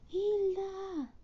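high call across a crowd. Acted. Mother calling for her lost daughter. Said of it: "grita la mamá".

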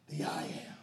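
God's name whispered to Moses; hushed I am. Acted. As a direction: breathe it out in awe. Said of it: "And God says".

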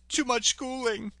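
high-pitched imitation of a frightened victim; mock tearful, high-pitched whine; crying clerk. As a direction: plead it in a high, put-on voice. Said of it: "And the guy's crying".

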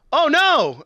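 high, loud hammed-up oh no; mock actress cry. Mocking Trini's acting to a friend, squeaky fake alarm. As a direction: overplay a bad actress's panic. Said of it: "then she's like".

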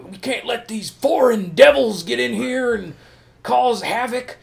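high, loud ranting politician; mock Trump-style stump speech; fire and brimstone speech. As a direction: thunder it like a demagogue at a rally. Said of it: "spitting fire and brimstone".

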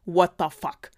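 loud, indignant outburst. Acted. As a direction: spit it out in outrage. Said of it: "empörte sich die Königin".

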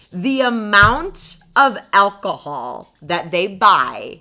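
loud, slow emphatic read; slow, loud, dramatic quoting; reading her note aloud. Retold or imitated. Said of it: "the note that I wrote was".

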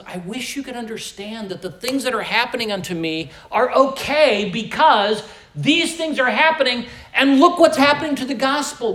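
building to a loud, high sermon peak; fervent pulpit crescendo; preaching at full force. Acted. Preaching Paul's words.

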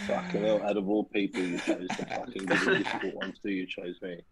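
ordinary talk, nothing marked

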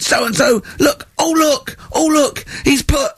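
a teary, very high hammed-up lament; sob-rant it like a wounded teacher; mock wailing teacher. Teacher reading out a pupil's graffiti, mock tearful high wail. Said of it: "He was going mad".

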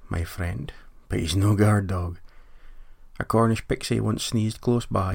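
ordinary talk

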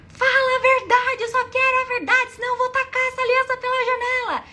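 high, loud furious yell; screaming. Retold or imitated. Girlfriend demanding the truth from her lying ex, screaming. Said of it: "eu comecei a gritar".

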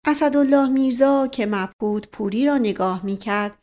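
ordinary delivery

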